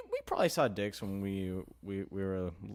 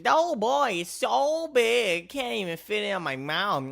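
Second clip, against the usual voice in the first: mock girly voice; 12 semitones higher, 6 dB louder than usual. Mocking a girl's reaction.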